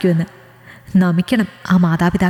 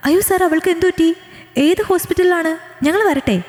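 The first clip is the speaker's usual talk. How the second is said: high, alarmed cry of worry; high anxious questions; 9 semitones higher than usual. Acted. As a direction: voice jumps up in panic for her friend. Friend hears classmate in hospital.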